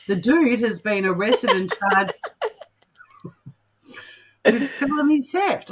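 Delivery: real laughter breaking into the read, pitch climbing; laughing while reading. Real, not acted. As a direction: crack up midway through reading the charge. Cracks up reading the absurd charge.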